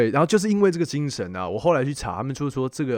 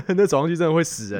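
laughing; 5 semitones higher, 4 dB louder than usual. Real, not acted. Recalling the brutal climb.

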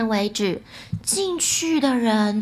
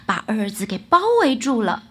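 plain reading voice

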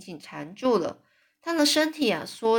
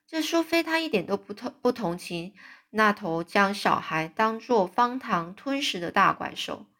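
ordinary delivery

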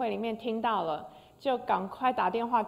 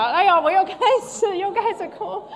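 tearful excitement; 10 semitones higher, 11 dB louder than usual. Real, not acted.

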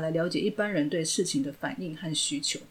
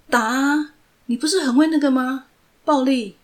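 taunting shout; 7 semitones higher, 7 dB louder than usual. Acted. Villain goads him to hit.

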